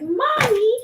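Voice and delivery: put-on high, loud little-kid call of Mommy; child calling mommy. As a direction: squeal it like a small child. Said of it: "He's like".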